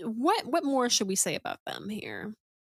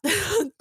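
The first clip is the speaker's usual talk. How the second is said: burst of laughter; 10 semitones higher, 4 dB louder than usual. Real, not acted. Laughing at too-much reading.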